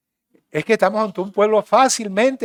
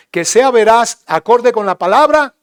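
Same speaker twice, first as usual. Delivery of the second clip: loud, higher emphasis; emphatic shout; 6 semitones higher, 11 dB louder than usual. Real, not acted. Pastor stresses truth to congregation, loud. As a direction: hammer home the point.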